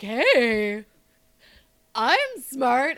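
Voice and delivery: lightly voiced boastful actor; mock smug Chevy Chase. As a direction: brag it like a dim star.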